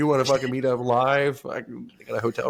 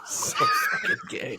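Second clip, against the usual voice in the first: mocking friend's voice; slower than usual. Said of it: "And he's like".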